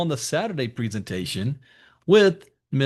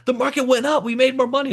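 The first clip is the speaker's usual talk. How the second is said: excited mom; 11 semitones higher, faster than usual. Retold or imitated. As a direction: squeal the good news like an excited mother. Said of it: "calls me up excited".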